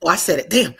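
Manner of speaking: ordinary talk, nothing marked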